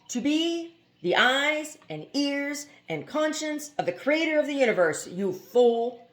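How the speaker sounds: slow, high, grandly declaimed; grand pronouncement. A narrator's reading. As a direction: proclaim it like a prophet. Trout's imagined written answer.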